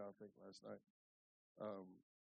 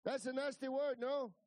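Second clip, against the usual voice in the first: high, loud indignant question; imitating Alvino; 13 semitones higher, 8 dB louder than usual. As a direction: play an offended ranch hand insisting. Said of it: "Alvino said".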